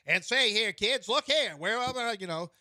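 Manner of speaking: put-on high, old-timey announcer voice; chirpy thirties newsreel patter; 1930s newsreel voice. Shown as a demonstration. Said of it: "my nineteen thirties voice".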